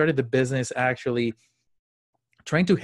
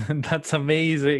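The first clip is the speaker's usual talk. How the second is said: laughing; 3 semitones higher, slower than usual. Real, not acted. Host laughs at story.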